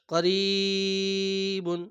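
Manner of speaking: slow, stretched Quranic chant of করিবুন; long, sung-out করিবুন; drawn-out recitation. Shown as a demonstration. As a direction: draw out করিবুন like tilawat.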